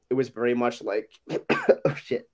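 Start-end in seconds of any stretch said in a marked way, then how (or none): 1.3-2.3 coughing fit mid-word. Real, not acted. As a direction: choke out the words coughing. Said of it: "he's fucking dying".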